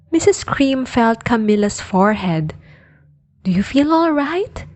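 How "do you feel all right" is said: a little higher, concerned mother; lightly voiced anxious mother; worried mother. A narrator's reading. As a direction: ask gently like a fretting mom. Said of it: "she asked".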